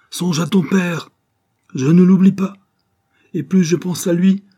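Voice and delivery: high, loud, impassioned outburst; heated quarrel. Acted. Mother and son clash over the father, loud and impassioned. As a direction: cry out with rising passion.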